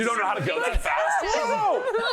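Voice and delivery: high laughing yell; laughing shout. Retold or imitated. Laughing panicked retelling.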